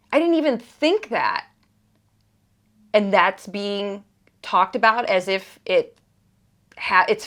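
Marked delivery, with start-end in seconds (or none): 0.1-1.5 exasperated. Real, not acted. Exasperated at false blame.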